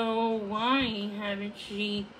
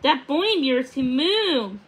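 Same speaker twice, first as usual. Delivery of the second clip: excited exclaim; 3 semitones higher, 7 dB louder than usual. A narrator's reading. Puffin exclaims.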